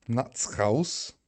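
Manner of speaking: mock Scouse, rising at the end; Scouse accent. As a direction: attempt a Liverpool Scouse accent. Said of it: "The Scouse one was good though".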